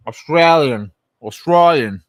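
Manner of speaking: loud, broad Australian Strine accent. Shown as a demonstration. Streamer nailing an Australian accent for chat. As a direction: belt out Australian like a drunk Aussie. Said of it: "to nail the Strine accent".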